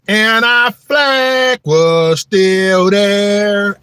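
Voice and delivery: very loud, high, stretched anthem shout; belted anthem yell. Retold or imitated. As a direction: belt the anthem line at the top of your lungs. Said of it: "And I yelled".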